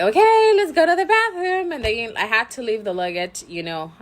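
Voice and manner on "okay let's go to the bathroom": high, loud mock-cheerful announcement; loud high mom call. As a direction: call it out brightly to the kids. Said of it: "I'm like".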